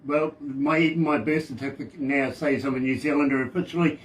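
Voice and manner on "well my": usual speaking voice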